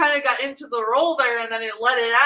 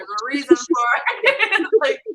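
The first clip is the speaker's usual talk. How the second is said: laughing; 4 semitones higher, 4 dB louder than usual. Real, not acted. Laughing through remark.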